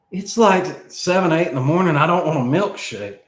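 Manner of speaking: you can't believe she is offering this; incredulous refusal. Retold or imitated. Refusing her milkshake at eight in the morning, incredulous.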